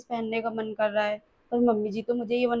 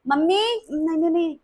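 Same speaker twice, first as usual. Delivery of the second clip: playful higher call to mom; teasing call; 6 semitones higher, 4 dB louder than usual. Acted. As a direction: tease her by calling mummy. Nanad teasingly calls mother. (मज़ाक में mummy को पुकारना)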